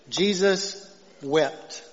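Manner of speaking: Jesus wept, drawn out very slowly; a hushed, reverent pause on the shortest verse; slow solemn reading. Shown as a demonstration. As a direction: let each word land, slow and weighty. Answers own question, verse quoted.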